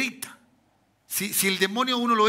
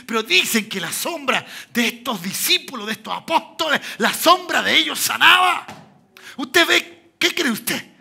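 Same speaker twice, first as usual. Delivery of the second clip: loud, high preaching shout; fiery pulpit cry; full-force preaching; 4 semitones higher, 5 dB louder than usual. Real, not acted. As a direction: drive it home to the congregation.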